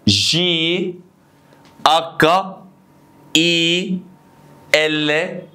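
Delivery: Italian letter names, sustained on an even tone; long, separated letter names for repeating; repeat-after-me alphabet. Shown as a demonstration. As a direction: lead learners through the alphabet letter by letter. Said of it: "حاول تقول معايا".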